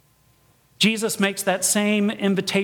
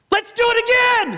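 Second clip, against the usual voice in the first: high, loud thrilled shout; acted excitement; 12 semitones higher, 5 dB louder than usual. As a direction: shout it like an elated rider. Acts out excitement after the ride.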